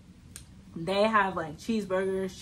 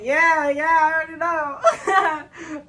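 laughing reply; 7 semitones higher, 7 dB louder, slower than usual. Retold or imitated. Retold answer to the cook.

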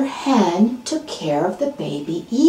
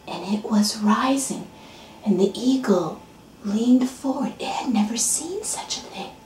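hushed storytelling; 4 semitones higher, slower than usual. A narrator's reading. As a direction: draw listeners into the hush. Hushed narration of sunrise.

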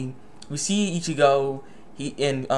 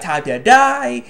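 slow, higher, louder menacing villain; villain line; 7 semitones higher, 6 dB louder, much slower than usual. Retold or imitated. Recapping the villain stabbing Ichigo, slow menace. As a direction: drag it out like a gloating villain.

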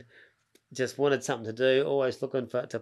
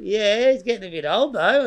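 reluctant hedging; 8 semitones higher, 6 dB louder than usual. Retold or imitated. Breeder puts off a keen caller, reluctant hedging. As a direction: stall him with a lifted, doubtful voice.

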